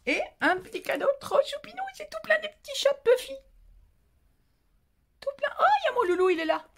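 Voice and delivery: cooing at a gift of kitten pictures, very high; cutesy gushing. Real, not acted. Gushing over cute cat gift.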